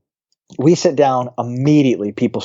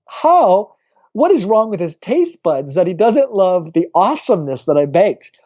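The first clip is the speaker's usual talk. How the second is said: incredulous; 6 semitones higher than usual. Retold or imitated. Incredulous about the cookie hater.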